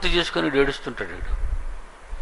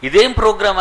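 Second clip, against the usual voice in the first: husband's annoyed question; 6 semitones higher, 4 dB louder than usual. Retold or imitated. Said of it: "అని అతను ఆపేస్తున్నాడు".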